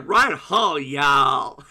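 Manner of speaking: loud, drawn-out American Southern drawl; American accent. Shown as a demonstration. Streamer showing off to the chat, hammed-up American accent. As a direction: put on a hammy American Southern twang. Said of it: "How's my American accent".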